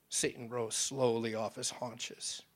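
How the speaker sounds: ordinary talk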